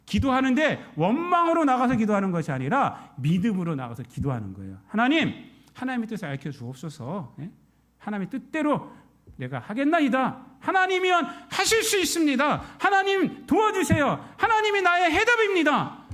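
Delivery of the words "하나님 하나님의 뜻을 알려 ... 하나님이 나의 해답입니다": crying out prayer. Acted. Believer crying out to God in prayer, building to a shout. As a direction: cry out to God with everything.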